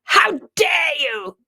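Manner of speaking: high, loud, drawn-out mock indignation; hammed-up outraged protest; mock outrage. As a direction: play an offended listener crying out. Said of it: "You're gonna be like".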